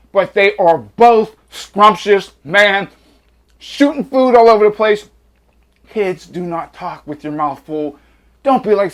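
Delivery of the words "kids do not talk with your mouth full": soft joking aside. Real, not acted.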